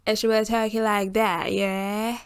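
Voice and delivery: exaggerated British accent, high, loud and stretched; gushing British girl impression with long drawn vowels; Sophie. Vlogger imitating a Love Island contestant, peculiar British accent. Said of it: "je fais un accent british un peu particulier".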